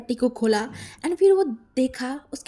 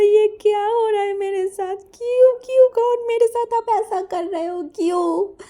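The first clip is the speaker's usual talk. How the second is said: high, tearful wail of why; crying plea; 9 semitones higher, 3 dB louder than usual. A narrator's reading. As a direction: sob out the why, pleading. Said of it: "जो कि रोना start कर दिया". (रोते हुए ऊँची आवाज़ में गिड़गिड़ाना)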